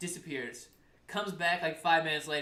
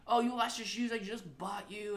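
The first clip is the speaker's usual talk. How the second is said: higher-pitched imitated scolding; mock screaming; 7 semitones higher than usual. Mom complaining about the lost new shoes, mock-angry. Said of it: "they were screaming at each other".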